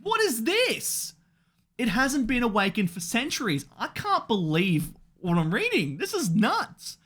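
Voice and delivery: high-pitched stunned outcry, slowed on the questions; reliving the shock of reading the chapter; squeaky, incredulous fan freakout; shocked disbelief. Retold or imitated. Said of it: "And now I'm like".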